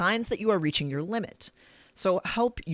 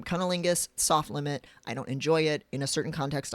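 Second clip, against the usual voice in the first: ordinary talk, nothing marked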